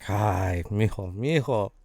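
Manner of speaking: low, slow, soft voice; heavy-hearted mother, hushed and drawn out; weary mom. Acted. Mom addressing her son mijo, weary and low. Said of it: "um, she's like".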